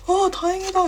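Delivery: overjoyed mock relief; relieved. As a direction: gasp of happy relief. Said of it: "이러면서".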